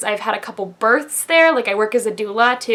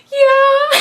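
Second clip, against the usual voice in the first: high, loud little yeah; squeaky timid yeah; 6 dB louder than usual. Retold or imitated. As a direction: answer like a scared child. Timid answer to radiologist.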